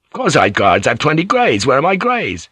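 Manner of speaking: agitated, high-pitched demand; alarmed insistence. Acted. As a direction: insist urgently, pitch jumping up. Alarmed, insisting on his guards.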